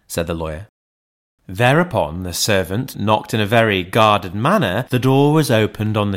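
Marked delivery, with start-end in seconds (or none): none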